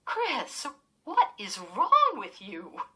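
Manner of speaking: loud, slow, shocked scolding; a mother snapping at her daughter in front of guests; angry demand. Acted. Mother scolding her daughter before the guests, loud and slow. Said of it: "Missus Powell demanded".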